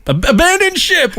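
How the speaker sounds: high, drawn-out cry of alarm; mock shout. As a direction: yell it like a sinking captain. Mock shouting abandon ship.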